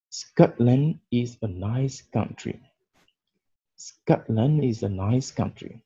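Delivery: "Biscuits are so good" read slowly, stress on "so"; slow example sentence. Shown as a demonstration. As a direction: read it slowly for learners to repeat. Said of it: "Deuxième exemple". (phrase d'exemple lue lentement)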